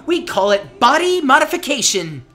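gleeful big announcement; excited. Acted. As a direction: proudly unveil it, thrilled. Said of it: "you sound way too excited about".

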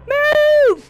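high, very loud drawn-out command; urgent yell. Real, not acted. Player urging a game character away, yelling.

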